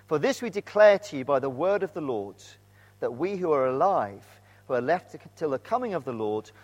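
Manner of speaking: measured, deliberate Bible reading; scripture reading. Shown as a demonstration. Preacher reads the verses to the church, steady and deliberate. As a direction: read the verses aloud steadily. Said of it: "Let's read those verses again".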